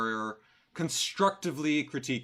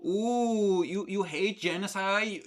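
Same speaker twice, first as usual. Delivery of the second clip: mocking baby talk; 7 semitones higher than usual. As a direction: coo at her like a child, sneering. Mocking the woman's clip.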